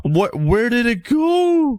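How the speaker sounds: high, slow, whining question; whiny disbelief. Real, not acted. Player to viewers, whining high in disbelief. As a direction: whine like the trade was stolen. Trade vanished.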